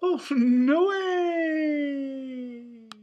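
high, loud, long drawn-out wail; cartoon dismay. A narrator's reading. Balloon launch failing, drawn-out cartoon wail. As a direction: stretch the last word way out in dismay.

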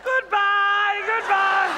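high, very loud teasing goodbye; loud sing-song farewell to the gallery. Acted. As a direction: sing it up to the cheap seats. Waving off the gallery.

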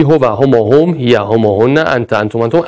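student answering. Retold or imitated. Voicing a student counting off pronouns, lightly. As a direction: mumble it like a pupil counting. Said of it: "میگی".

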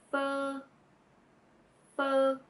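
f said as fuh, held; f plus the relaxed uh vowel. Shown as a demonstration. Tutor joins f and uh for learners. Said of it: "with the vowel".

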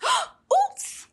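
very high startled oops; high surprised yelp; surprised cry. A narrator's reading. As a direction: caught out, squeak it. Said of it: "cried Froggy".

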